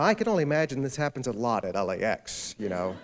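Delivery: normal talking voice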